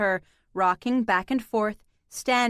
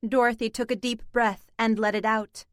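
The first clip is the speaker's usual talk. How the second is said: plain reading voice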